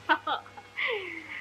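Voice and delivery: nervous giggle. Acted.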